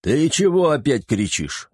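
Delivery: irritated demand for an explanation; displeased. A narrator's reading. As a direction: ask it slowly, with annoyance. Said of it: "недовольно спросил Шер".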